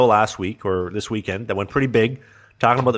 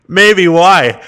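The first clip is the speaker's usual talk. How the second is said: cheeky reply; 7 semitones higher, 12 dB louder than usual. Retold or imitated. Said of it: "And I'm like".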